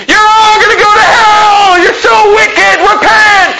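high, loud hammed-up yell; mock street preacher scream. Pastor imitating street preachers: a hammed-up high scream. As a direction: scream repent at passersby, mocking.

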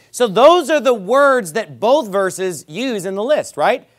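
ordinary talk, nothing marked

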